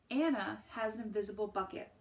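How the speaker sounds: plain reading voice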